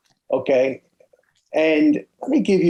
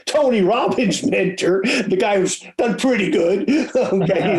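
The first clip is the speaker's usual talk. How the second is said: higher-pitched, chuckling as you talk; amused laughing; 8 semitones higher than usual. Real, not acted. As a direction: laugh through the words, voice lifted. Joking aside, laughs while talking.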